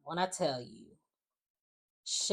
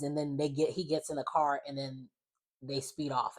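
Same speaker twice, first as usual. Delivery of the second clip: normal talking voice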